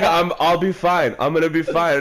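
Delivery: mock insisting that all is fine; insistent reassurance. Imitating girlfriend insisting in a quarrel.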